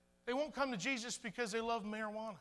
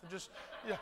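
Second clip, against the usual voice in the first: normal talking voice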